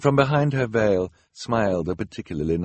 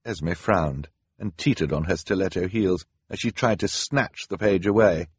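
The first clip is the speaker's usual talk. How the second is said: ordinary delivery, nothing marked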